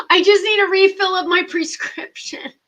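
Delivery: loud, high exasperated wail; exasperated plea. Retold or imitated. Patient pleading for a prescription refill, exasperated wail. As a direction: plead at the end of your rope, loud.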